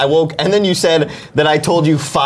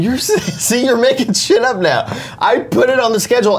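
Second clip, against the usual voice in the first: high, laughing protest; 10 semitones higher than usual. Real, not acted. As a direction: push back while half laughing. Laughing protest over made-up claims.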